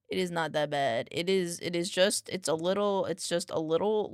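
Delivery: ordinary talk, nothing marked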